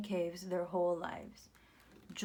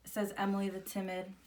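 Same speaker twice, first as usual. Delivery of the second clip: plain reading voice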